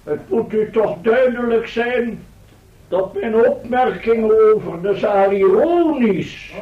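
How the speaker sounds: calm the crowd from the podium; formal speech. Acted. Professor continues his address. (plechtige toespraak, soms vertraagd)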